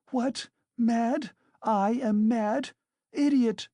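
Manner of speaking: high, loud, outraged shriek; a mocked widow shrieking at the lawyer; shrieking fury. A narrator's reading. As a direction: shriek it back at him. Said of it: "shrieked Katerina Ivanovna".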